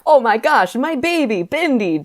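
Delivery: high mock exclamation, falling on the name; hammed-up German dad greeting his baby; exclaimed, German dad. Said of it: "Dad exclaimed in his German accent".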